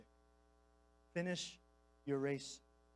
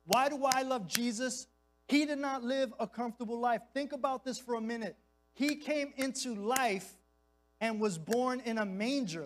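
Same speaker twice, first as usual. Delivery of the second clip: fervent preaching; 5 semitones higher, 4 dB louder than usual. Real, not acted. Preaching peak, raised voice.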